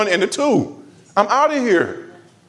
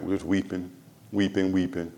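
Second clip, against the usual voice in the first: hushed mourning; 9 semitones lower, 10 dB quieter than usual. Retold or imitated. Recalling mourners weeping.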